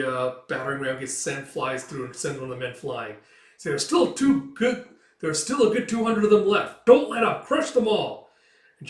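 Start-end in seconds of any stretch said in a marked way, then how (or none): 3.6-8.3 very high, loud driving order; commanding shout. Acted. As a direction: keep the attack going. Commander urging men on.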